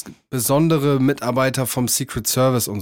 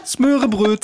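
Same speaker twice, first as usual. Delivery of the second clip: mock Swedish; 9 semitones higher, slower than usual. Mocking Swedish words.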